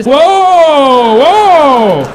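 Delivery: sudden loud alarmed whoa; startled whoa. Acted. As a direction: jump back in alarm. Startled cut-off reaction.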